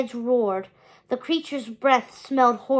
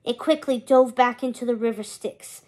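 ordinary delivery

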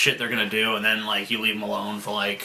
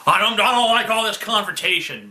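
imitating whiny dad; 10 semitones higher, 3 dB louder than usual. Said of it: "our dad used to get really fucking upset".

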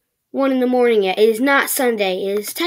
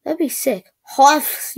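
usual speaking voice

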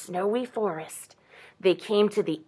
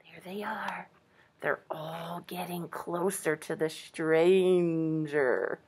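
slow, very soft, lower whisper; suspenseful whisper; 5 semitones lower, 8 dB quieter, slower than usual. Real, not acted. Reader watches animals creep up, whispering slowly.

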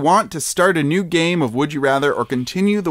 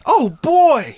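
high-pitched cartoon squeal; anime voice; 10 semitones higher, 4 dB louder than usual. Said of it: "work on my anime voice".

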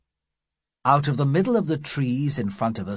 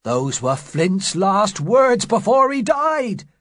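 frightened cry; 8 semitones higher, 5 dB louder, slower than usual. Acted. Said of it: "cried Morgan".

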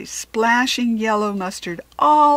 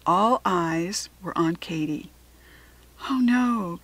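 ordinary delivery, nothing marked

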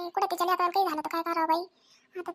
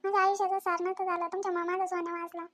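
remorseful apology; 7 dB quieter than usual. Acted. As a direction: quietly beg forgiveness, ashamed. Mother apologizes tearfully. (आई पश्चात्तापाने हळू आवाजात माफी मागते)

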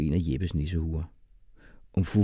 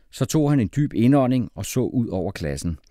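ordinary delivery

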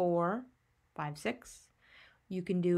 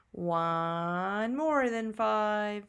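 slow teacher prompt; much slower than usual. Shown as a demonstration. Teacher drawing out counting answer.

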